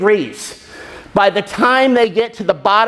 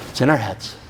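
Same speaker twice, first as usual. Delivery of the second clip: "It's in our heads" repeated lower and much softer; 9 semitones lower, 6 dB quieter than usual. Shown as a demonstration. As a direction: let it sink in, quietly.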